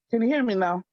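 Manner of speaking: ordinary talk, nothing marked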